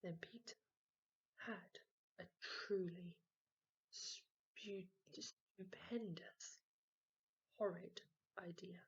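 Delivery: slow, sly whisper. A narrator's reading. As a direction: whisper it like a wicked secret. Hushed sly idea.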